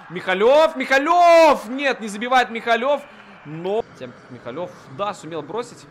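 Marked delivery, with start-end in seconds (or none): 0.1-3.0 building to a loud high shout; excited hockey call. Real, not acted. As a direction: call the name louder each time. Chance, rising calls. (нарастающий громкий крик)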